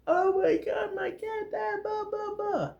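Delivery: very high, squeaky mock wail; mock heartbroken. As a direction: imitate him wailing in a tiny high voice. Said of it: "He's so sad. He's so broken about it".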